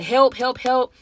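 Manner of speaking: a hushed mock shout for help; mock cry for help. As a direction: pretend to yell for help, half-hearted. Imagined calling for help in car.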